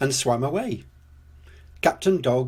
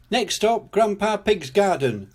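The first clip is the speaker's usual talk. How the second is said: higher-pitched stop announcement; announcing; 7 semitones higher than usual. A narrator's reading. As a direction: announce it like a conductor. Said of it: "announced Grampy Rabbit".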